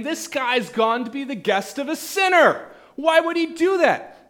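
high, loud outraged complaint; indignant grumble. Acted. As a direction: protest the guest choice, loudly.

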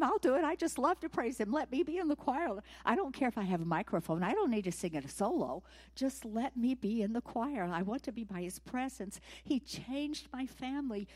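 high, loud, pleading to join in; eager pleading. Retold or imitated. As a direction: beg to be let in, all fervour. Obed-Edom begging to join choir.

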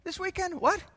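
high-pitched, incredulous mock parent; disapproving parent. Parent confronting a newly converted child, shocked and high-pitched. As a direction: play a parent scandalized by their child.

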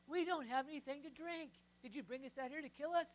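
loud, high griping at Moses; whiny complaint. Acted. Israelites griping at Moses in the wilderness, loud whiny complaint. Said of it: "they complain".

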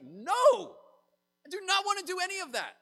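high, loud mock refusal; mock outraged refusal. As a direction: shout it back like a horrified Joseph. Joseph refuses God's plan.

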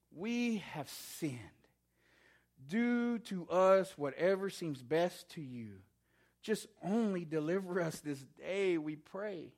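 slow, subdued prayer of confession; contrite plea. Acted. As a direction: plead humbly, voicing the repentant people. People's contrite prayer, acted.

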